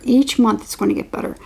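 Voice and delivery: usual speaking voice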